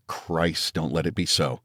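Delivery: plain reading voice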